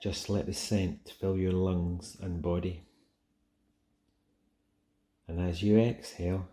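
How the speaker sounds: ordinary talk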